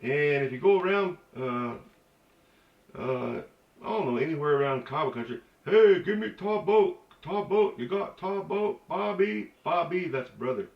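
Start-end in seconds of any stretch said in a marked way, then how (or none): 5.6-10.1 high, loud, drawn-out call; mimicking local folks. Local calls to a brother for tobacco, mimicked high and loud. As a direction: hail a buddy for tobacco, pitched up. Mimics people around Kiowa country.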